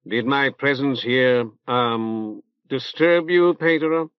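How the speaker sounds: unctuous prelate, drawn out; reverberant nasal voice. Acted. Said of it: "he inquired in a reverberant nasal voice".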